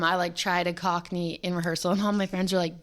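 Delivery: usual speaking voice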